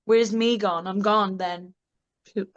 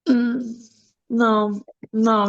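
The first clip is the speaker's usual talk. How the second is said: ordinary talk, nothing marked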